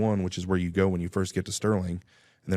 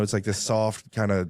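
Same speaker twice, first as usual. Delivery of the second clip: normal talking voice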